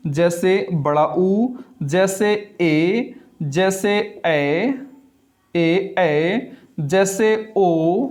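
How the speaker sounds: each vowel held long and slow; long vowels drawn out. Shown as a demonstration. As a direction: stretch every vowel sound slowly. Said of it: "वो स्वर कौन कौन से होते हैं?".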